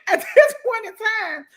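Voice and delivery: high, loud laugh breaking into the words; real laughter spilling over the story. Real, not acted. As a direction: talk through a burst of laughter.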